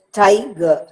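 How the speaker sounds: "Tiger" with the Ti stressed, ger light; stress on first syllable. Shown as a demonstration. Teacher showing how to read a trochee, "Tiger" stressed on Ti. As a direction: hit Ti in "Tiger", let ger fall away. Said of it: "Ti receives the stress".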